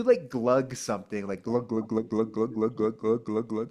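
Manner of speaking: glug glug, throaty swallowing noise; glugging sound. Shown as a demonstration. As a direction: make the glugging gulp sound. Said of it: "pretend like you're glugging a drink".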